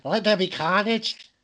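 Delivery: high, slow, drawn-out mimicry; imitated film line. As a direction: stretch it like a hammed-up trailer quote. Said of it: "is that what he said?".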